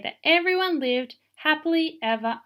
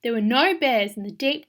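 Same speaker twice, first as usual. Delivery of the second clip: ordinary delivery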